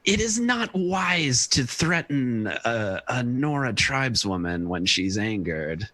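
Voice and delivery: slow, measured warning in character; drawn-out, casual word of caution. Acted. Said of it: "would be kind of a casual, like".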